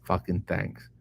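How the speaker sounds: normal talking voice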